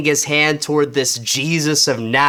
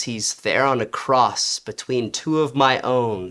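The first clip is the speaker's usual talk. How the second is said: plain reading voice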